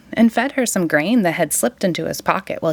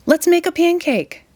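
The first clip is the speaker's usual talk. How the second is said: higher, eager plea; eager boy; 9 semitones higher than usual. A narrator's reading. Jack begs mother for a pancake, eager plea. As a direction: push for the pancake, pitched up. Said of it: "shouted Jack".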